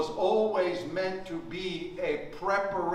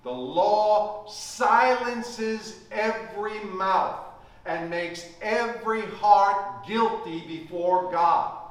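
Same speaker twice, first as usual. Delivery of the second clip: normal talking voice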